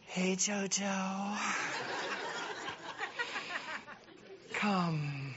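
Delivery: slow, soft, sultry mock seduction; seductive temptress. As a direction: purr it slowly to lure him in. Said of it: "this is my interpretation".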